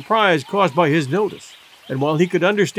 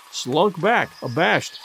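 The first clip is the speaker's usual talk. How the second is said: usual speaking voice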